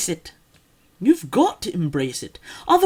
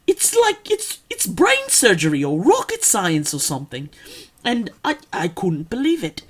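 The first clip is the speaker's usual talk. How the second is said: put-on, over-enunciated British accent. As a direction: play a stiff, proper British speaker.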